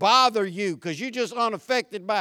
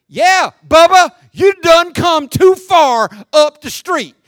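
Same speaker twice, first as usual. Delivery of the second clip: loud, slow, higher sarcastic jeer; loud slow mockery; mocking retort; 7 semitones higher, 13 dB louder, slower than usual. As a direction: jeer at Bubba, dragging it out.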